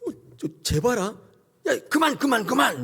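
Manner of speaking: high, urgent cries to stop; startled pleading. Acted. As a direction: frantically cut them off, acted alarm. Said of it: "그때 하나님이 깜짝 놀라신 거예요".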